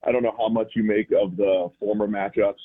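normal talking voice

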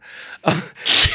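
breathy burst of laughter; laughing. Real, not acted. Laughing about not wanting pants on. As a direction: laugh at yourself.

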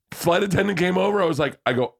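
ordinary talk

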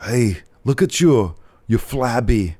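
imagined tough fitness coach; Walter. Pretend. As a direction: goad a slacker slowly, in mock-coach style. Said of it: "if Walter was doing your tonal".

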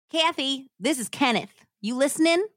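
acted angry husband on the phone; demanding. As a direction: confront her in a hillbilly twang. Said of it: "he demanded".

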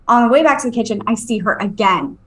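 ordinary talk

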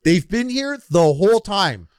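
high, loud conspiratorial announcement; dramatic reveal. Acted. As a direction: shout the big reveal. Conspiracy reveal repeated louder.

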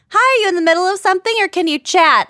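high fast chirpy mom voice; mom voice imitation. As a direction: squeak it out like an eager mom. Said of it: "she's like".

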